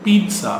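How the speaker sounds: Pizza as PEET-sa, high first syllable; correct pronunciation. Shown as a demonstration. Teacher modelling the s sound, correct pronunciation. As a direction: say Pizza with a crisp ts. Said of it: "எஸ் sound தான் வரும்".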